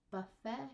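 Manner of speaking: "buffet" ending in an open vowel, buh-FAY; silent T. Shown as a demonstration. Teacher showing pronunciations, t left silent. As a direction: leave off the t of "buffet". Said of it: "pronunciation when you're changing".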